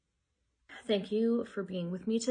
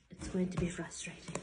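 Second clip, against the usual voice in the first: whispered warning; 6 dB quieter than usual. Real, not acted. Warning viewers, resigned.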